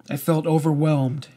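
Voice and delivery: ordinary delivery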